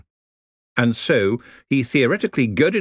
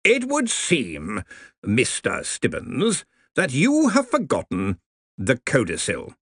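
each word savoured, very slow; slow and deliberate; 4 semitones higher, slower than usual. Acted. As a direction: roll every syllable out slowly. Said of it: "turning over every letter like some delicate and precious thing".

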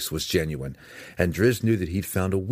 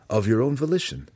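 higher, insistent question; probing, with a sharp upward lift; pressing question; 7 semitones higher, faster than usual. A narrator's reading. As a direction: press him for an answer. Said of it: "Drizzt pressed".

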